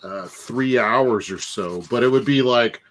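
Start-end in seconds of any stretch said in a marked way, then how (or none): none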